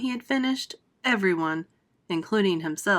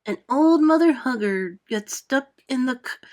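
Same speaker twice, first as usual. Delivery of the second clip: centipede's rollicking song; 2 semitones higher, slower than usual. Acted. Centipede sings favorite song.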